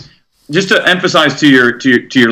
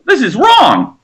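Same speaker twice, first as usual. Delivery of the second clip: mock outraged guru; 7 semitones higher than usual. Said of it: "they're going crazy now".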